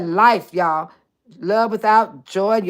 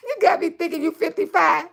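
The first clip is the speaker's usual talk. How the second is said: high words through laughter; laughing; 7 semitones higher than usual. Real, not acted. Telling Trina about the age mix-up, laughing through it. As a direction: say it while still laughing.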